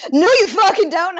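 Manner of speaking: high, loud mock outburst; imitating an emphatic veto; retold sharp refusal. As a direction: cut them off with a mock yell.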